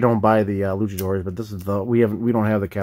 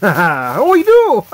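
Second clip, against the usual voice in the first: surprised exclamation; 10 semitones higher, 10 dB louder, much slower than usual. Real, not acted. Surprised to find a chase.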